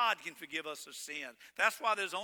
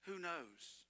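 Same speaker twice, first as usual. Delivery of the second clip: much softer, sorrowful who knows; hushed sad echo; sad, quiet; 13 dB quieter than usual. Real, not acted. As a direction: let it fall quietly in grief. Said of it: "That's what's so sad to me".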